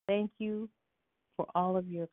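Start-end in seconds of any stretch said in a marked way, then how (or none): none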